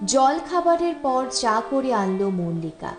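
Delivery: ordinary delivery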